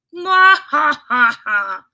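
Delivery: loud, high mock cackle; big staged "mwa-ha-ha" laugh; hammed-up laugh. Reacting to a viewer's joke.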